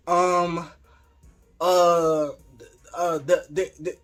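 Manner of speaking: imitating a tongue-tied stammer; stuttering. Husband caught out when his wife walks in, stammering. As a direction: trip over fillers and repeated syllables. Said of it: "he just started stuttering".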